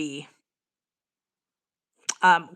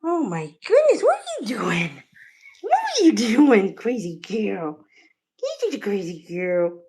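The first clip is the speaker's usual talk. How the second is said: cooing to cat. Real, not acted.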